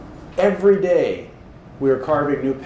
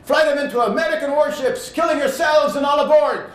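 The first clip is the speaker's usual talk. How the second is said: higher put-on commander voice, shouting the orders; Japanese commander; 9 semitones higher than usual. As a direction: play the Japanese commander from the old war movie, voice pitched up. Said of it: "Japanese commander go".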